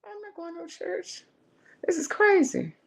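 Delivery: high, stubborn refusal; protesting. Retold or imitated. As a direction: push back, half disbelieving. Arguing with the inner voice.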